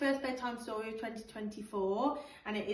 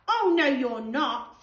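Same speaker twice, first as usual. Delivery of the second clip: put-on much louder stubborn child's yell; mock-outraged kid refusing; indignant refusal; 3 semitones higher, 11 dB louder, slower than usual. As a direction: shut them out, loud and firm. George refusing again.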